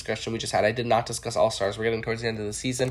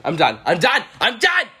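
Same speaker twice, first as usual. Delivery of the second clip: rising louder and higher; building mock exasperation; 8 semitones higher, 8 dB louder than usual. Sign-off getting more dramatic. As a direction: build each one higher. Repeated, rising each time.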